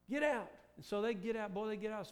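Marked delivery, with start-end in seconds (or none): 0.1-0.5 second "Get out" higher and louder; stronger get out. Acted. Pharaoh driving Israel out, raised voice. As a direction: push the second one up. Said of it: "Pharaoh finally says".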